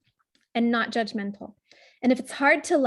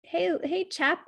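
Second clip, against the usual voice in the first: put-on higher, softer gentle call to a boy; tender voice to a child; 5 semitones higher, 3 dB quieter, much slower than usual. Shown as a demonstration. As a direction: coax a hurt twelve-year-old gently. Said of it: "talk to him as if he's actually a twelve-year-old boy".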